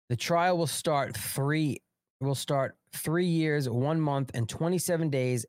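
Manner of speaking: slow, deliberate. Real, not acted. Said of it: "slow emphatic restart".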